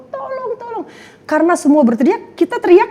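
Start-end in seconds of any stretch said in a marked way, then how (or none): 0.1-0.8 high, thin mock cries for help; high imitated wail of tolong; crowd crying for help. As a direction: imitate lost souls calling out. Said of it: "entah laki, entah perempuan".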